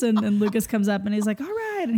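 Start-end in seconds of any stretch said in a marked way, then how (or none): 1.4-1.8 rallying call. Retold or imitated. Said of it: "he's so good at rallying".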